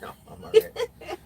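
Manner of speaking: squeaky laugh through words. Real, not acted. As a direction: laugh while you answer. Refusing bucket, laughing.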